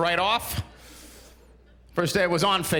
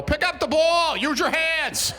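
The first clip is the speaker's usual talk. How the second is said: put-on snapping at the kids; 10 semitones higher, slower than usual. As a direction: yell at the kids to pick up the ball. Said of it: "you just wanna snap and go".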